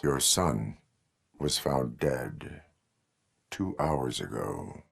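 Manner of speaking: slow, low and soft, with pauses; grave, subdued. Acted. Officer telling a parent of a death, hushed and grave. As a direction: break bad news quietly and carefully.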